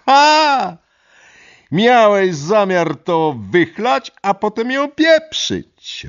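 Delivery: very high, loud and slow triumphant shriek; triumphant shout. Acted. Lidia holding up the whisky to her boyfriend, a high triumphant shriek. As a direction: crow it out, victorious and furious. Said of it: "wykrzyknęła triumfalnie".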